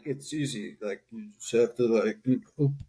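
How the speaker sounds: slurred, numb-lipped Stallone mumble; Stallone impression. Friend's Stallone bit retold to cohosts, slurred mumble. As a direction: mush the words like a sleepy Stallone. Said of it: "he was just like (Stallone impression)".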